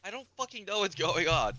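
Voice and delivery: high, loud mock frustration; retold exasperation. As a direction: act out snapping back at parents. Retold exasperated reply to freaking-out parents.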